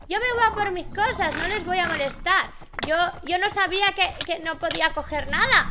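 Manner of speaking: loud pleading. Real, not acted. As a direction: insist loudly she means no harm. Justifying herself to villagers. (suplicando en voz alta a los aldeanos)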